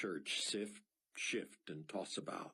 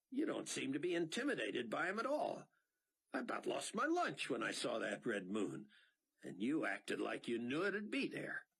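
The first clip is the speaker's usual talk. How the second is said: higher, agitated pilot; Mac's voice; 7 semitones higher than usual. A narrator's reading. Pilot pressing Rayford about the blood moon, agitated. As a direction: fire off puzzled questions.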